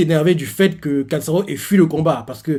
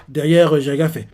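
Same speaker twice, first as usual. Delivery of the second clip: normal talking voice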